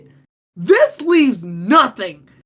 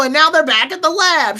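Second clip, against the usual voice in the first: normal talking voice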